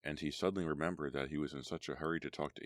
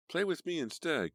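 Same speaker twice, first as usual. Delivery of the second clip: put-on high, loud whiny child voice; little girl demanding attention; 13 semitones higher, 5 dB louder than usual. A narrator's reading. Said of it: "Courtney demanded".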